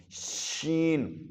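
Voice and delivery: شین stretched slowly with a hiss at the start; drawn-out sheen. Shown as a demonstration. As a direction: draw out شین, whistle first. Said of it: "شین کے شروع میں بھی شا کرنا ہے".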